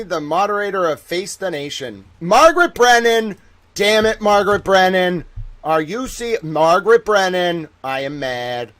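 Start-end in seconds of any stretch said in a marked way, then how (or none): 2.2-7.7 higher and louder, slow accusing call; mock accusing shout. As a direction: call her out across the room. Calling out accusingly.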